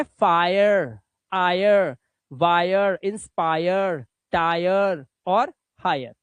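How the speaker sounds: slow drawn-out examples; slow model words. Shown as a demonstration. Teacher modelling fire-sound words slowly. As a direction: stretch each word out. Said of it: "इसने कर दिया आय".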